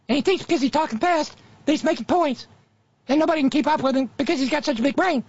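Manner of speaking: high, fast mocking cartoon voice; squeaky sped-up falsetto mockery; Ben Shapiro impression. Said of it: "fast-talking castrato imitating Mickey Mouse".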